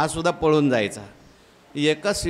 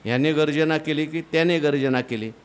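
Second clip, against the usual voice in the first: ordinary delivery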